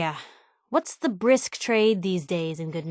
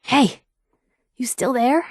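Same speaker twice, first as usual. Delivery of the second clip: ordinary talk, nothing marked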